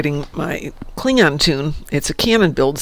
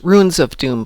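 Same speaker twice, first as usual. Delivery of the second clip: ordinary talk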